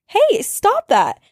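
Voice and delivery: high, loud, drawn-out cry to stop; alarmed shout. Retold or imitated. Seeing her friend cut her wrist, alarmed shout. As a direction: yell to stop her hurting herself.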